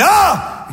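sudden high-pitched scream of pain; pained yelp. Acted. Patient scalded by hot coffee in a joke, high mock scream.

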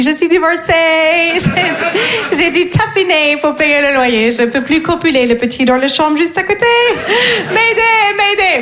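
put-on trying to sound cool with an English accent; fake English accent. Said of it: "parler français avec un accent anglais".

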